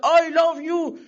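high, drawn-out mock shout of I love you; mock yell. Student in the crowd shouting to Obama, hammed-up cry. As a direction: call it out like a starstruck student.

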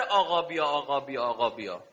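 a hypocrite's hollow "come, master", dying away; mocking imitation. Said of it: "ادا در میاره".

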